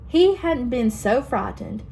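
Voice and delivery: ordinary delivery, nothing marked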